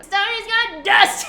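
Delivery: high, loud, slow growled lyric; imitating a growl. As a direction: growl the line like a live singer. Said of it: "what was that part where she growled".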